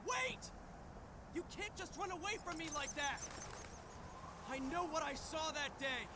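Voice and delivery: high, pressing shout; calling after her. Acted. Luka calling after the fleeing Bayonetta, urgent shout. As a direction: yell after someone running off.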